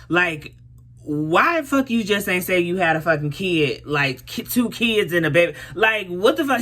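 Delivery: retold heated exasperation; exasperated callout. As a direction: tear into him, fed up. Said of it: "So I'm like".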